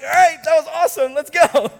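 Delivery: hammed-up eagerness, ready to leave; eager mock excitement. Preacher recalling his own reaction, mock excited.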